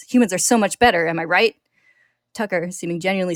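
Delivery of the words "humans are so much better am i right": loud, mocking rage; sneering fury. A narrator's reading. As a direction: throw it back at him. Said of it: "Ed continues".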